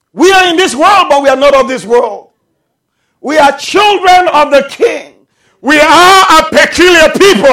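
loud, high, emphatic pulpit voice; full-force preaching. Real, not acted. Preacher telling the church they are a peculiar people, full-force preaching. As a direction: proclaim it to the whole congregation.

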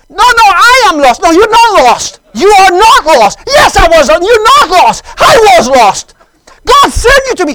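high, full-volume back-and-forth bellowing; shouted argument. Retold or imitated. Two lost men arguing in the woods, shouting. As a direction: yell each contradiction over the other.

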